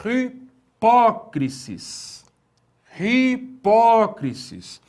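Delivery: hipócrisis drawn out slowly with an h; slow Greek reading. Shown as a demonstration. Teacher drills the Greek word for students. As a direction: spell out hipócrisis slowly with a breath. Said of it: "que é a palavra".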